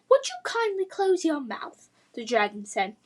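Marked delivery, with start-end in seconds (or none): 0.1-1.9 higher, prim English lady's voice; posh English accent. Acted. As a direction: primly scold her in a posh English accent. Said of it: "posh English accent".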